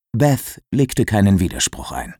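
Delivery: ordinary delivery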